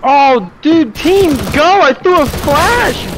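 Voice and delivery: high frantic shouting; panicked yelling. Real, not acted. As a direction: yell out in panic. Gamer panicking mid-round.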